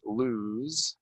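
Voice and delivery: "Lose" ending in a buzzing z; Z sound. Shown as a demonstration. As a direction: say "Lose" as looz. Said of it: "It's gonna sound like a Z".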